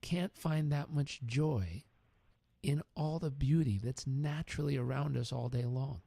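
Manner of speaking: slow earnest. Retold or imitated. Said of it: "And he said".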